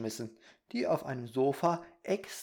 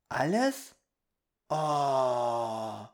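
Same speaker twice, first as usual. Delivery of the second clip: put-on amazed boy; 3 dB louder, much slower than usual. Peter hears anything is possible, amazed. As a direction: marvel like a wide-eyed child.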